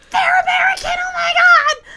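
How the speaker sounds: very high, loud mock scream; hammed-up shrieking impression of an obnoxious fan; screamed imitation. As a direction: screech it like an overexcited girl spotting foreigners. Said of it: "'cause she screamed".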